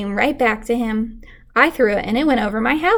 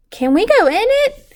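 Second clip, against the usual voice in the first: put-on high, eager boy's voice; a kid begging to go inside; lightly voiced boy pleading to see the treehouse; Jeremy asking; 11 semitones higher, 4 dB louder than usual. A narrator's reading. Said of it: "he asked".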